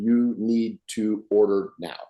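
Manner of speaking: slow and blunt, words spaced out; slow, blunt final call to order. Shown as a demonstration. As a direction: cut the talk short, firmly and slowly. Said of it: "As soon as I go to the small words".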